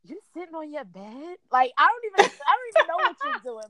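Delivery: rising to a loud, high, indignant rant; mad rant. Retold or imitated. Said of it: "that made me mad".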